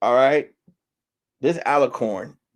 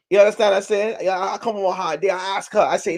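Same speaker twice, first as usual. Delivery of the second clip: ordinary talk, nothing marked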